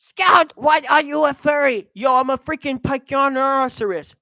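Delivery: loud indignant exchange. Acted. Acted argument about a furry suit.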